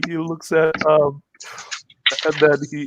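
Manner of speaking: usual speaking voice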